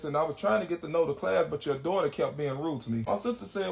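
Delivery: usual speaking voice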